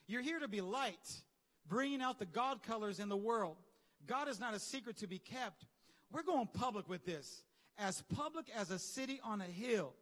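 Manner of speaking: preacher reading the Bible with fervor; scripture reading. Acted. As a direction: proclaim the verses to the congregation. Reads scripture aloud with force.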